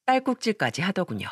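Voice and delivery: ordinary delivery, nothing marked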